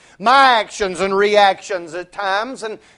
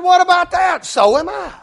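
much higher, gleeful mock amazement; delighted surprise; 10 semitones higher than usual. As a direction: sell the coincidence with glee. Man voiced, delighted.

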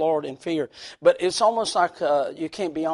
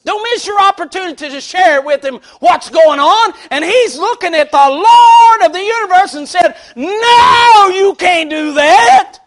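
preaching; 13 semitones higher, 16 dB louder than usual. Acted. Preaching at full force.

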